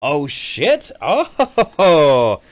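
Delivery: loud, high "Oh" of real surprise; startled loud oh; surprised exclamation. Real, not acted. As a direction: blurt it out, caught off guard. Reacts to hearing Finland.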